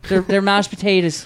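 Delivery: put-on Irish accent. Irish guest voicing his relatives in a mock Irish accent. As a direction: lay on a thick Irish accent for "mashed potatoes". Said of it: "I wanted to hear an accent".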